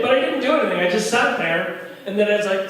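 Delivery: normal talking voice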